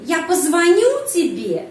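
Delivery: позвоню pushed up in pitch, then falling; question stress on позвоню. Shown as a demonstration. Teacher showing how to turn a statement into a question, stress on позвоню. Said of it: "penekanan pada kata kerja позвоню".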